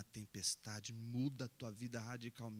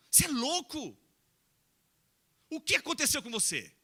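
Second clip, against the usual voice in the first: loud, higher exasperated scolding; scolding outburst; 14 semitones higher, 14 dB louder than usual. Acted. Neighbours scolding Abraham for abandoning his secure life, loud exasperated scolding. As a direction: yell at him like he's lost his mind.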